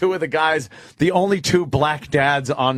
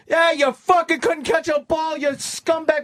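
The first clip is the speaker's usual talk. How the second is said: heckler jeer; slower than usual. Retold or imitated. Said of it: "people going".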